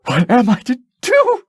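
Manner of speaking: put-on high, worried girl's voice; a frightened child asking for help; anxious princess. Princess crying out in fear.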